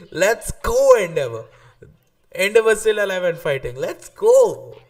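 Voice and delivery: retold cheering. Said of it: "I was like".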